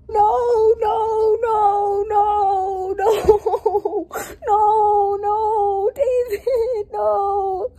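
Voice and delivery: high, pleading crying protest; distraught crying denial; tearful no's. Real, not acted. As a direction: beg the book not to do it. Distraught reaction to plot twist.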